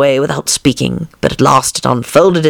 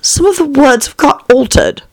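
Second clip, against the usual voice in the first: put-on high girlish Alice voice; timid Alice; 12 semitones higher, 3 dB louder than usual. A narrator's reading. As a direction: confess it in a small raised voice.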